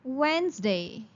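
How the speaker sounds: Wednesday as WENZ-day; teacher models Wednesday without the d. Shown as a demonstration. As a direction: say Wednesday with no d sound. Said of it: "you say Wednesday".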